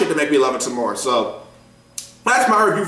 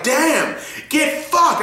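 higher, gloating get fucked; gleeful damn; 10 semitones higher, much slower than usual. Retold or imitated. Watching the villain get beaten, higher gleeful jeer. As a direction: cheer the beating on. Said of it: "I was like".

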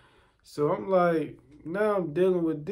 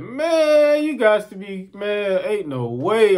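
high, loud, drawn-out protest; loud disbelief; 6 semitones higher, 6 dB louder, slower than usual. Retold or imitated. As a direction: cry out that it cannot be true.

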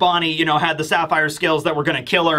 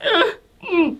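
much higher whimpering mock sob; imitating Bonnie crying; 14 semitones higher, slower than usual. Bonnie walks out after crying all night, high mock whimper. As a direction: squeak out little teary whimpers. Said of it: "she's still like".

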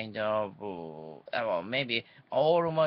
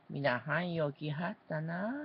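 higher-pitched Kyoto dialect greeting; demonstrating a mock Kyoto accent; fake Kyoto accent; 5 semitones higher than usual. Shown as a demonstration. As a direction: put on a Kyoto lilt. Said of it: "fake accent, uh, Kyoto language".

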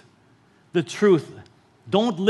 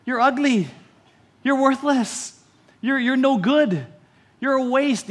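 higher, taunting inner voice; cruel inner parrot; 5 semitones higher than usual. Acted. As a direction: sneer the insults as your inner critic.

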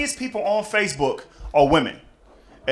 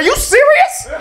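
squeaky, very high mock shock; shocked disbelief; 6 dB louder than usual. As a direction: shriek it in disbelief. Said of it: "I was like".

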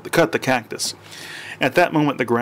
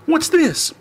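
high, alarmed question; startled. Acted. Husband startled by a giant squirrel, high alarm. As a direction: jump at the sight of the squirrel.

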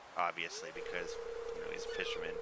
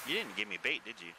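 ordinary delivery

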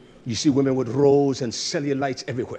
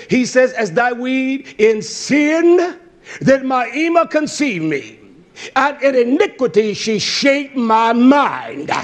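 high, loud, mounting sermon delivery; full-force preaching; 9 semitones higher, 8 dB louder than usual. Acted. Preacher relaying the order to kill without pity, full-force preaching. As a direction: preach it hard, building toward a shout.

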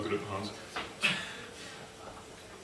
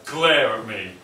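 ordinary talk, nothing marked

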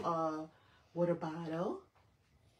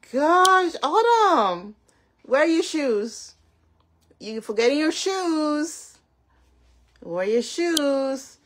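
loud exclaiming; 5 semitones higher, 12 dB louder, slower than usual. Real, not acted. Surprised shoes missing.

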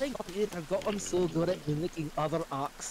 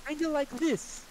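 switching out of Scottish accent; 8 semitones higher than usual. Shown as a demonstration. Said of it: "I can switch out of it almost instantly".

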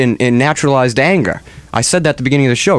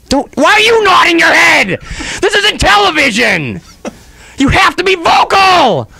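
exasperated yelling; 3 dB louder than usual. Real, not acted. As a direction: scold at the top of your voice. Exasperated host yells at cohost.

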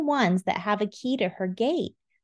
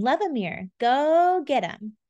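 ordinary delivery, nothing marked

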